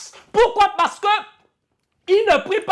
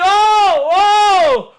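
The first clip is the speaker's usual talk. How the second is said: high, loud wail of panic; panicked cry; 5 semitones higher, 6 dB louder than usual. Retold or imitated. Apostles in the storm crying out to God, panicked wail. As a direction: cry out in terror. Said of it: "Et il crie".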